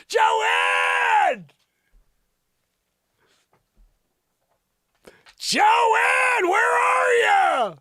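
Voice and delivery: very high, loud yell; calling out. Pretend. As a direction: holler for someone lost in another room. Calling out for missing Joanne.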